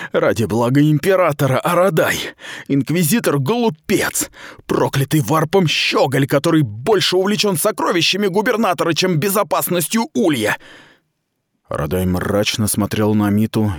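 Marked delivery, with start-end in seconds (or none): 0.1-10.6 higher, heated rant; indignant outburst. Acted. Mita rails against the inquisitor, indignant. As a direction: vent your contempt.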